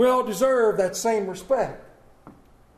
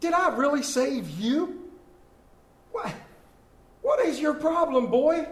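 a hint of a scolding Jesus, rising higher; mild mock reprimand, building to the word boy; lightly voiced Jesus scolding the preacher; stern Jesus rebuking; 5 semitones higher than usual. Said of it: "he'd look at me and go".

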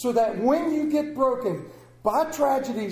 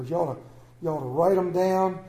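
ordinary talk

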